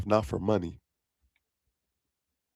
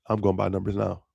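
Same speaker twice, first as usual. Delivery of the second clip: ordinary talk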